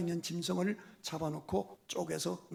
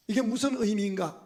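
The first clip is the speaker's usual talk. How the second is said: ordinary delivery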